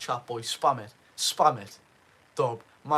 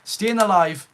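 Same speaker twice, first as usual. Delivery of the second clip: calling out; 4 semitones higher, 9 dB louder than usual. Real, not acted. Calling out to a viewer.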